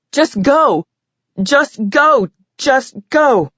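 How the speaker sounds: high repeated cry of just go; mock scream. A narrator's reading. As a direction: shout it three times, pleading. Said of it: "I screamed at him".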